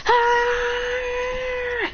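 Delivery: long high powering-up yell; battle cry. Acted. Mitsuki unleashing Sage Mode, a long high battle cry. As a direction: hold the cry as power surges.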